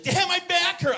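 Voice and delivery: put-on high, loud child's complaint; mock a six-year-old's loud grumble; complaining little girl. Daughter to dad at breakfast, high loud complaint.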